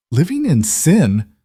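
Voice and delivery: slow, high disbelieving echo; incredulous. A narrator's reading. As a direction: throw the words back in disbelief. Colt echoes accusation.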